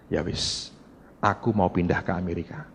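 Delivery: low, quiet giving in; soft resigned yes. Retold or imitated. Wife quietly agreeing to move to America, resigned. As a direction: concede it softly, resigned.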